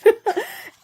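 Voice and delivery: high giggle of delight; happy laugh. Real, not acted. Showing off her new book, giggling happily.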